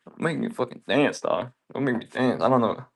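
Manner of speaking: usual speaking voice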